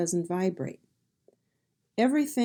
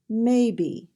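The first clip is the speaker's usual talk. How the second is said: MAY-be, first syllable loud, falling after; "maybe" front-stressed for a learner; 2 semitones higher, 5 dB louder than usual. Shown as a demonstration. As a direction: hit the MAY of "maybe" firmly. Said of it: "The stress is on the first syllable".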